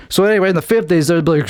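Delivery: normal talking voice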